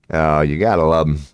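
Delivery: ordinary talk, nothing marked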